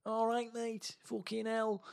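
high, soft put-on Manchester voice; Manchester accent. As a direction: do a squeaky, hushed Mancunian impression. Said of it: "with a Manchester accent".

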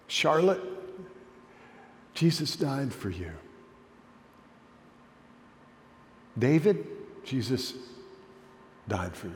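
soft, intimate naming; tender personal address. Shown as a demonstration. Pastor speaking softly to each listener by name. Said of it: "call out every one of your names".

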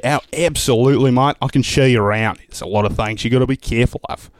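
broad Aussie accent; matey Australian tour guide. Shown as a demonstration. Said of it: "You wanna go to Australia".

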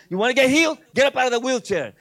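shouted faith-healing challenge; commanding healer. Retold or imitated. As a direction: order him up with full force. Retells commanding a man to rise.

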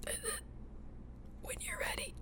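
breathing-exercise guide; guided breathing. Acted. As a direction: guide the breath in and out, whispering the release.

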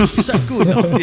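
put-on English accent. Imitating Bob Hoskins breaking character in English accent. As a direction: snap out of the American voice into broad English. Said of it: "English accent".

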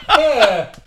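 high-pitched laugh and groan. Real, not acted. Laughing while overwhelmed by heat.

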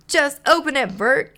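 high, loud howl of impatience; loud pleading outburst; impatient howl. A narrator's reading. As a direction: howl it, can't wait any longer. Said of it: "he howled".

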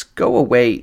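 ordinary delivery

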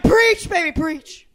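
loud, high gospel shout of praise; Southern Gospel. Pretend. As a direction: holler it like a gospel congregation. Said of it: "Southern Gospel".

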